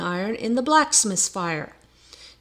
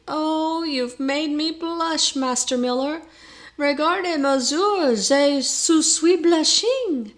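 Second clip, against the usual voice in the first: put-on higher, slow, affected French accent; coy French-accented exclaim; 7 semitones higher, 3 dB louder, slower than usual. A narrator's reading. Prioress exclaiming to the miller in coy French accent. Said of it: "she exclaimed".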